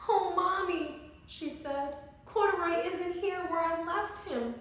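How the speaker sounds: put-on a small girl missing her bear; little girl Lisa. Lisa voiced by reader.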